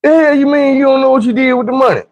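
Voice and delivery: high-pitched incredulous demand; outraged disbelief. Retold or imitated. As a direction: challenge them in a high, indignant voice. Kids confront mom over lost money.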